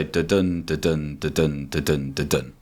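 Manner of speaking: da-dun chanted low, weak then strong beat; steady da-dun pulse, unstressed then stressed; iambic rhythm. Shown as a demonstration. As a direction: tap out the iambic beat softly. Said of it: "I always use this reference".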